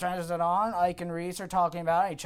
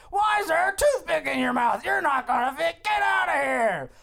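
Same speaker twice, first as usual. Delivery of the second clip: bellowed dismissal; 10 semitones higher, 5 dB louder than usual. Acted. Boss kicking Buck out, bellowing. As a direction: throw him out with a mock-furious holler.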